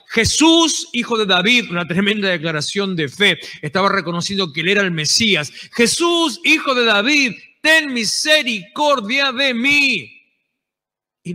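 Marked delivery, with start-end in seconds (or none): 0.1-1.7 high, loud cry of Jesús; desperate shout. Retold or imitated. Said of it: "a los manotazos y gritaba". 5.7-10.1 pleading shout. Retold or imitated.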